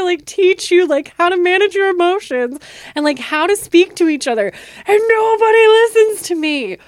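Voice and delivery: put-on high, loud exasperated yell at the kids; flipping out at the kids. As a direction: scold the bickering kids at full volume, pitch way up. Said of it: "totally flipped out".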